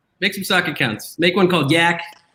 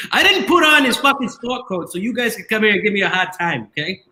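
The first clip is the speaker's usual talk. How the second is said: a hint of a Jersey accent, pushy and gruff; mock Jersey tough guy; 4 semitones higher than usual. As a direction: play a Jersey guy complaining, lightly accented. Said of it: "was that your Boston accent".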